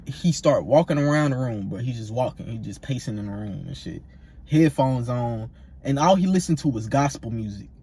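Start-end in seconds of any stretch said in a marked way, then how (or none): none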